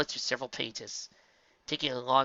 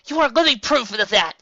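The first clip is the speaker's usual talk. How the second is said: exclaimed; 11 semitones higher, 12 dB louder than usual. A narrator's reading. Twilight exclaiming to Spike. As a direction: burst out with it.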